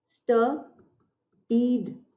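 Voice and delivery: "स्टडीड" drawn out very slowly, falling at the end; slow demo pronunciation. Shown as a demonstration. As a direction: model "स्टडीड" for the class very slowly. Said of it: "अब spelling बन जाएगी स्टडीड". ("स्टडीड" बहुत धीरे, साफ़ उच्चारण में)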